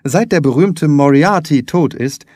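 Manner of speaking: usual speaking voice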